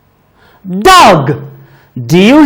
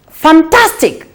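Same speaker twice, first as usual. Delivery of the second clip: higher bright praise; teacher praise; 7 semitones higher than usual. Real, not acted. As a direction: warm encouraging praise. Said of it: "praising child".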